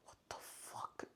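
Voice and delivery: very soft, slow, breathy whisper; baffled whisper. Retold or imitated. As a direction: barely breathe the words, spooked. Frightened after grandpa finds nothing.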